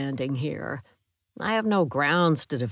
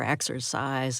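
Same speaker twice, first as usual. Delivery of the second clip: ordinary delivery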